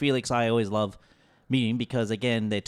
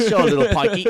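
louder, higher mock Cockney; Cockney reply; 9 semitones higher, 9 dB louder than usual. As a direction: toss it back in a hammed-up Cockney accent. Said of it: "And I'm like".

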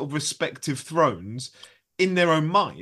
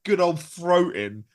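usual speaking voice

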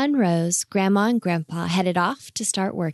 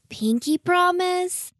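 put-on high, slow hopeful child; 11 semitones higher than usual. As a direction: ask it like an eager little girl. Child asks pinky promise eagerly.

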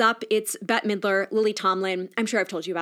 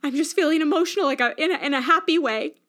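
higher, choked-up reassurance; teary but happy; 6 semitones higher than usual. Retold or imitated. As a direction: hold back happy tears while reassuring mom. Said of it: "I was like".

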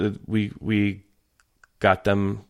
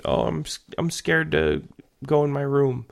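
put-on whiny child; 7 semitones higher than usual. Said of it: "she complains".

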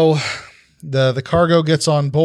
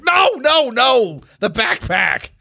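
high, alarmed correction; much higher-pitched exasperated objection; frantic goblin inventor; 8 semitones higher, slower than usual. Acted. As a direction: protest in a squawking character voice. Goblin frantically corrects his helper.

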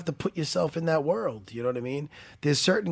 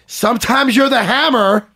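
loud proclamation; 7 semitones higher, 12 dB louder than usual. Real, not acted. Proverb declared loudly.

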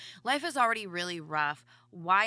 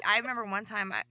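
ordinary talk, nothing marked

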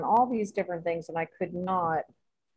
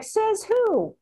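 high indignant challenge, pitch much higher; incredulous retort, voice shooting up; mock indignant; 3 dB louder than usual. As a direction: fire back in disbelief at the agent. Indignant retort to PayPal agent.

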